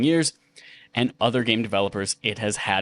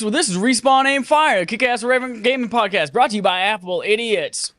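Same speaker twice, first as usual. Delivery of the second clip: fake Southern accent; 10 semitones higher, 4 dB louder than usual. Host greeting listeners in a fake Southern accent. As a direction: put on a cowboy host accent, fake and proud. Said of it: "not my real accent".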